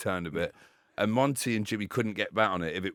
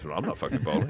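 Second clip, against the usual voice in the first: lightly voiced moody refusal; 5 semitones lower than usual. Broad refusing to bowl.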